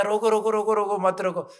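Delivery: high, loud calling out; urgent halt. Retold or imitated. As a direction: call out to stop it at once. King rushes to halt the hanging.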